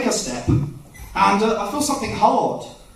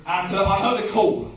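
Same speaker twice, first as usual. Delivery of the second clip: usual speaking voice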